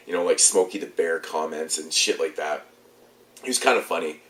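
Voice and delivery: usual speaking voice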